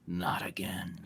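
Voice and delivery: dramatic breathy whisper. A narrator's reading. Narrating Graham's fear to the group, in a whisper. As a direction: hush it like a secret dread.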